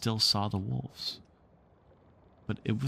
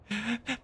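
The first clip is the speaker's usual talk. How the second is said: fairy giggle; 13 semitones higher, 6 dB quieter than usual. Acted. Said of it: "Tink's reply rang out".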